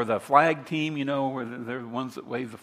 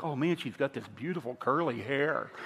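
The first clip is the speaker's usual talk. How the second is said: put-on admiring teen boy; 8 dB quieter than usual. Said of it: "he's like".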